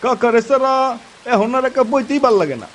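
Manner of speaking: put-on eager young friend, lightly done; lightly voiced young friend. (হালকা তরুণ বন্ধুর গলা)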